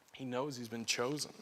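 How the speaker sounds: quietly, letting it sink in; soft repeat. Acted. Preacher to retreat group, repeating it softly. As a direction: drop to a hush on the repeat.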